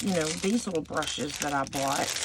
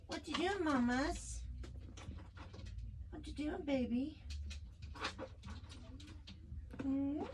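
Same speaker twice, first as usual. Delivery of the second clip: much higher, soft cooing pet talk; 10 semitones higher, 11 dB quieter than usual. Real, not acted. Talking to her sick dog in high, soft baby talk.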